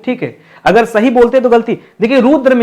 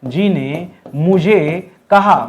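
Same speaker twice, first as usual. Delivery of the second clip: very slow dictation; 3 semitones lower, much slower than usual. Shown as a demonstration. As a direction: dictate the corrected sentence slowly. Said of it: "क्या होना चाहिए?". (धीरे-धीरे बोलकर लिखवाइए)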